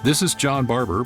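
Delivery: ordinary talk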